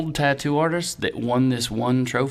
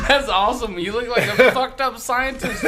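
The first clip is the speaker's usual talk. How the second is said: laughing hard, pitched up; 9 semitones higher, 4 dB louder than usual. Real, not acted. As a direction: laugh through the words. Laughing at friend.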